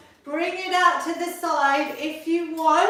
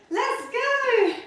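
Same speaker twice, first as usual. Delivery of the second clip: pitched way up, bursting with energy; excited let's go; 9 semitones higher than usual. Real, not acted. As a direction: fire the class up into the set. Coach launching a set.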